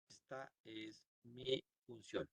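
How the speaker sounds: very soft, slow, word by word; murmuring while typing. Real, not acted. Teacher typing the string aquí que diga, slow murmur. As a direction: dictate it to yourself slowly, barely audible.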